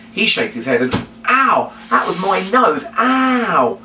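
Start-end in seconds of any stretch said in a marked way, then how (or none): 1.3-3.8 mock pain. Hit on the nose by puppet.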